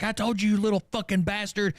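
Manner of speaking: high-pitched angry mom; acted-out mom telling off her son; angry scolding. Mom scolds son over dog mess.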